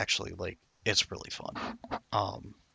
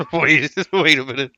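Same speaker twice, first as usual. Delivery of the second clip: louder and higher through laughter; laughing; 6 semitones higher, 12 dB louder than usual. Real, not acted. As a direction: laugh out the words. Bursting out laughing at the game.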